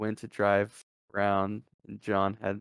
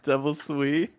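laughing; 5 semitones higher, 4 dB louder, slower than usual. Real, not acted. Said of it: "laughing at old message".

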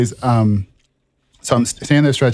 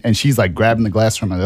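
ordinary talk, nothing marked

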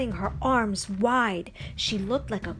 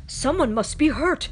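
higher and louder, alarmed; worried; 6 semitones higher, 6 dB louder than usual. A narrator's reading. Boy worried by a scream he hears. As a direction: sound the alarm, someone's in trouble. Boy fears someone is hurt.

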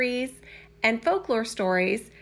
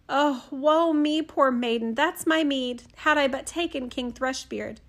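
lament; 3 semitones higher than usual. Acted. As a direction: bewail your fate like a ruined princess. Princess laments again.

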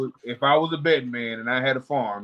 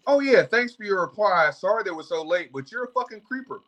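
mock-polite, higher-pitched parody of a reply; passive-aggressive; 7 semitones higher than usual. Club owner answering a banned customer, passive-aggressive. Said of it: "the passive aggressive tone".

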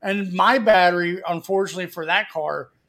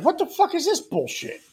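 much higher, startled outburst; alarmed surprise; 10 semitones higher than usual. Retold or imitated. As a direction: yelp it, caught off guard by the wheel. Steering wheel shaking, alarmed.

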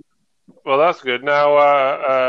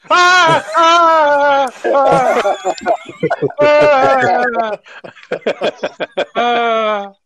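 high, loud imitated screams; wife's scream; 8 semitones higher, 3 dB louder, much slower than usual. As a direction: scream like someone broke in. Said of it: "she screams like I'm about to murder her".

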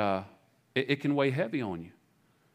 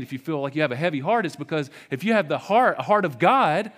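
rising, much louder and higher preaching; preaching at full force; 7 semitones higher, 6 dB louder than usual. Real, not acted. Pastor urging the hurting church, building loud and high. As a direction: build it to a passionate peak. Sermon climax.

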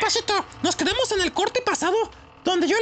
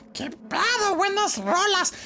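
ordinary talk, nothing marked

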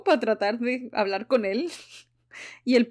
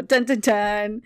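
dramatic sting; 3 dB louder, much slower than usual. Pretend. Dramatic reveal sound mimicked.

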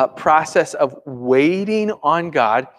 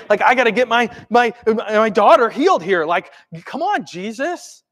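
higher, exasperated appeal; impatient pleading; 6 semitones higher than usual. Acted. Worried father pressing Jesus to hurry, impatient. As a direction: plead like a fed-up parent.

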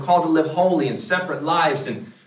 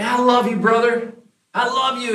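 high, sugary mock sincerity; fake gushing love; 7 semitones higher than usual. Church member gushes at the pastor in high mock sweetness. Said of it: "That sounds really fake".

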